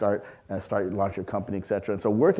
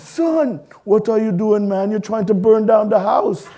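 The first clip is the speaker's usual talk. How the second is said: put-on higher, louder Trinidadian-accented scolding; upset Trinidadian dad; 11 semitones higher, 8 dB louder, slower than usual. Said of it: "He didn't have a Trinidadian accent much until he was upset".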